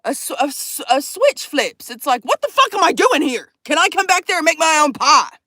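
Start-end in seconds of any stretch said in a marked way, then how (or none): 2.2-5.4 higher, heated inner outburst; mock outrage at being ignored; exasperated rant.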